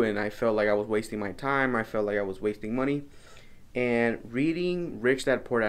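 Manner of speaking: normal talking voice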